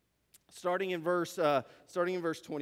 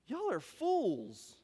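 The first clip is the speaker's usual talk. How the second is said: very slow, higher-pitched put-down; bold rebuke; 4 semitones higher, much slower than usual. Acted. Stranger has the audacity to rebuke two travelers, bold. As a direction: draw out the insult with audacity.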